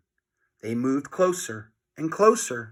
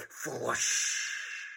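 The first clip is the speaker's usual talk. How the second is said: whooshing flush noise; soft hissing flush; mimicked toilet flush; flush sound; 3 semitones lower, 12 dB quieter than usual.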